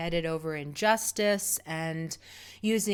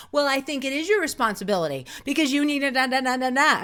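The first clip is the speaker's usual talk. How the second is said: fast, higher, louder snippy retort; snippy old self; 6 semitones higher, 6 dB louder, faster than usual. Retold or imitated. Her hot-headed old self lecturing the runner, fast and snippy. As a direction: rattle it off like a know-it-all. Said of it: "my old self who would have been like".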